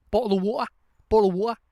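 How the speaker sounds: put-on "Bottle of water" in a British accent, the T's dropped into glottal stops; a quick British "bo'le of wa'er". Teacher mimicking British speakers, glottal British accent. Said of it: "the British are known for saying".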